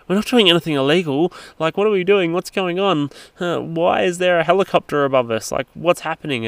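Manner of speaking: acting out the wife's alarmed questions; panicked questions. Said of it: "Kirsten looked at me and goes".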